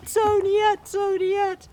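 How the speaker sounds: high, loud mock wailing of his name; wailing for Toniet. Wife wailing her husband's name, high mock wail. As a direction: call his name in hammed-up grief. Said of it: "she got even more upset".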